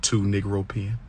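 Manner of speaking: low, slow, hushed count; soft, deliberate counting under a steady stare; counting to oneself. Shown as a demonstration. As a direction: count silently to yourself, barely voiced aloud. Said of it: "Count to yourself".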